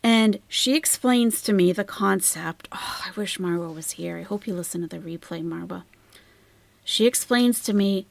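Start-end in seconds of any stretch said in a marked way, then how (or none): 2.7-5.8 unvoiced aside to an absent friend; whispered aside. Real, not acted. Aside to her friend Marwa, whispered quickly.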